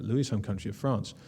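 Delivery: usual speaking voice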